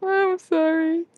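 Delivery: high, drawn-out "sorry"; long, high-pitched coo; cooing apology. Real, not acted. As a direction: apologise slowly in a high pet-talk voice.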